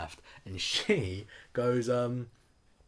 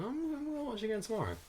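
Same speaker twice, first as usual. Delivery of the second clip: excited fan; 13 semitones higher, 3 dB quieter than usual. Retold or imitated. Excited fan planning to rewatch.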